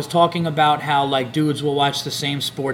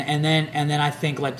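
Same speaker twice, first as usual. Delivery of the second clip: usual speaking voice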